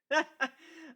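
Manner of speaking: high, loud menacing laugh; evil laugh. A narrator's reading. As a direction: cackle over the beaten foe. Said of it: "I laughed".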